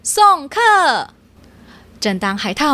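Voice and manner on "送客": high, loud shout to show guests out. Acted. Otter shouting the cats out the door, loud shout. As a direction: bellow it to end the visit. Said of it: "海獭大声喊".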